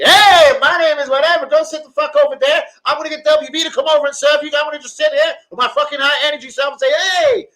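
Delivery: imitating the chirpy American GM at the door; mocking American manager. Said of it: "that was the American motherfucker".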